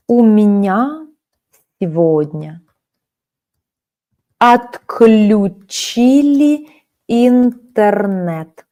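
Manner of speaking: slow dictation. Shown as a demonstration. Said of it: "teacher dictates word by word".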